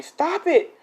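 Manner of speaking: high falsetto plea to stop; mock protest. Uraraka to the boys fighting, high mock protest. Girl protesting in acted story.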